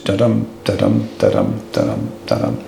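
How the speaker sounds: da-dum da-dum, the second syllable of each pair stressed, dropping low; iambic beat. Shown as a demonstration. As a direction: tap out the heartbeat rhythm of the verse. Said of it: "strong beat of iambic pentameter".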